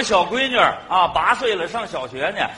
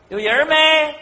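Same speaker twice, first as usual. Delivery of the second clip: higher-pitched call into an empty room; long, raised questioning call; 6 semitones higher, 3 dB louder, slower than usual. Acted. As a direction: call out to check if anyone's in. Calling into maybe-occupied room.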